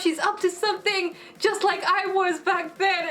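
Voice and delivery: high-pitched indignant mimicry; high, worked-up character quote; suspicious accusation. Voices character's indignant suspicion of Akira.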